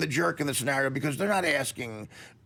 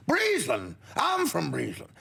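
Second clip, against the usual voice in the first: high-pitched mock-Irish astonishment; excited joke Irishman, pitch soaring; lame Irish brogue, amazed; 10 semitones higher than usual. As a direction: marvel at the coincidence in a hokey brogue. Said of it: "do a lame brogue too here".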